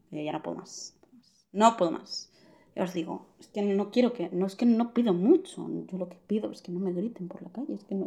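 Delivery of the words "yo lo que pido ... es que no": whispered complaint; frustrated whisper. Real, not acted. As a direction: whisper it like a confession. Confiding frustration.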